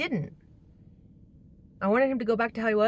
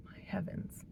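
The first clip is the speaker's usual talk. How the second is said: breathy whispered aside; just breathe the words out, worn down; 13 dB quieter than usual. Real, not acted. Exhausted aside after laugh.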